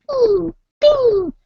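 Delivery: high, loud "bing"; ping like a dashboard alert; very high imitated chime; imitated warning light. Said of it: "The E light's on".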